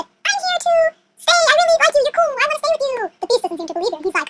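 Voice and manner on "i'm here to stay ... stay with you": high, put-on girly voice; gushing sweet talk. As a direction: lay on fake affection in a high voice.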